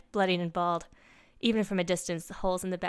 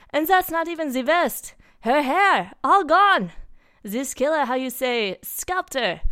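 put-on loud, high, horrified French accent; hammed-up French character in shock; distraught Frenchman; 7 semitones higher, 8 dB louder, slower than usual. A narrator's reading. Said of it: "put-together Frenchman they knew".

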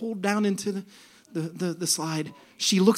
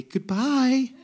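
higher, sweet singsong farewell; joyful send-off; 5 semitones higher than usual. Retold or imitated. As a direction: send them off gently and cheerily. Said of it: "gently waft them towards the slide and say".

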